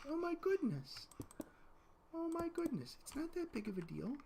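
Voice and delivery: very high soft cooing. Real, not acted. Cooing to baby.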